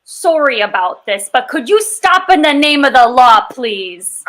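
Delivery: apologetic Canadian Mountie; Canadian accent. Acted. Player as a Mountie politely stopping someone, Canadian accent. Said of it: "Good job on the Canadian accent".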